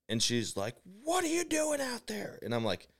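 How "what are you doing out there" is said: very high mock yell, held back in volume; imitated frantic woman. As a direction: mimic her losing it in a high falsetto. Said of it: "this lady is losing her mind".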